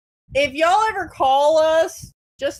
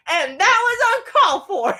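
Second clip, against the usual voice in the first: high, louder prim scolding; mock prim rebuke; 9 semitones higher, 5 dB louder than usual. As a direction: tut at him like a prim supervisor. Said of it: "she just goes like".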